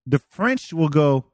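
ordinary delivery

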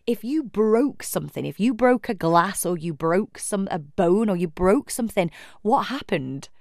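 ordinary delivery

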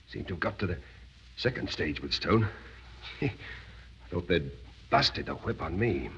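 low, faint, worn-out voice after a beating; barely getting the words out; hurt prisoner speaking low and weak; weak and exhausted. Acted. Forsyth after flogging, weak.

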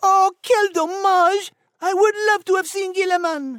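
theatrical French sigh of regret; French-accented disappointment. Acted. Nigel laments missing Gilliman, high French accent.

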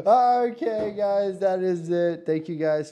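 high, loud, slow show outro; loud announcer sign-off. Real, not acted. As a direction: call out the wrap-up to listeners.

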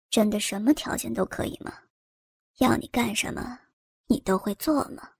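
low, hushed doubtful man's voice; a low, soft voice that can't quite believe it; a sly older man asking quietly, doubtful; doubting man. A narrator's reading. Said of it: "不相信地问道".

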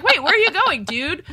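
mock outraged heroine; indignant surprise. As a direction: call after him, puzzled and put out. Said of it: "she's like".

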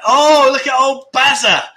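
loud, high greeting to a newcomer; excited shout. Real, not acted. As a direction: call out happily to the arriving viewer. Greeting a viewer arriving.

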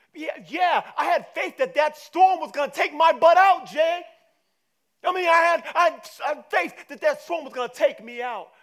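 high, shouted comic protest; indignant retort. Acted. Disciples answering Jesus about faith, indignant shouting. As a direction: yell back in exasperation.

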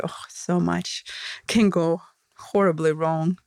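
normal talking voice

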